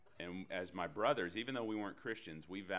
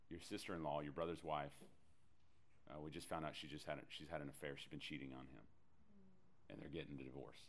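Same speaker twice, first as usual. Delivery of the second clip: quiet retold mother; 4 semitones lower, 9 dB quieter than usual. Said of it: "She's hysterical. She's crying".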